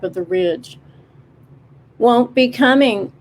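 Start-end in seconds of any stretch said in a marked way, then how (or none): 2.0-3.1 a touch of a judgmental bird squawk; clipped, judging bird voice. A narrator's reading. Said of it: "in a somewhat judgmental squawk".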